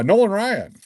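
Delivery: high, loud burst of delight on the name; excited reveal. Real, not acted. Card breaker to stream viewers, excitedly announcing a big pull.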